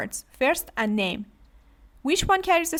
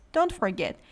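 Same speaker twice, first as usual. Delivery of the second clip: ordinary delivery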